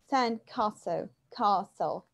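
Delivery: slow British "sand castle" with a long A; British English CASTLE, the A drawn out as "kah-sul"; British pronunciation. Shown as a demonstration. Teacher showing students the long A, British pronunciation. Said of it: "Em inglês britânico".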